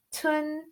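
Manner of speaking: TUN high, going up; second tone. Shown as a demonstration. Teacher showing the second tone, TUN high and rising. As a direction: lift TUN upward like a question. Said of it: "tun goes up".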